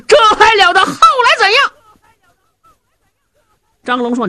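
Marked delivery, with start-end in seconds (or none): 0.1-1.7 alarmed outcry. Acted. Zhao Hu alarmed at the news.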